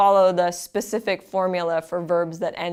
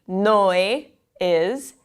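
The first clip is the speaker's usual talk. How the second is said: ordinary delivery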